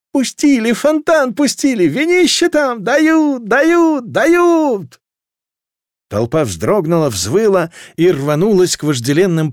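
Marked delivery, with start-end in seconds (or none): 0.1-5.0 high, frenzied crowd cry; excited shouting. A narrator's reading. Townsfolk crying that wine is being given out, frenzied. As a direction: shout the news across the square.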